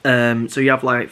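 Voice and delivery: normal talking voice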